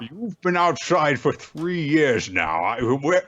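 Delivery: high joking Phil voice. Retold or imitated. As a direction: ham it up until you crack up. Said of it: "voicing Phil joking".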